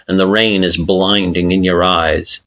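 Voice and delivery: ordinary delivery, nothing marked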